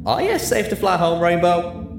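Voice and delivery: high, drawled country concern; concerned cowpony. A narrator's reading. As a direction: ask a drunk friend if she's okay. AJ asks Rainbow.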